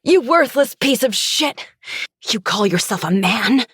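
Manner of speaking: high, venomous woman's insult; spitting fury. A narrator's reading. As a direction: spit it out shrieking, pitched well up. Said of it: "she spits".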